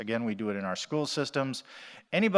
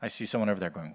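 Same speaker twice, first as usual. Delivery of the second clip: usual speaking voice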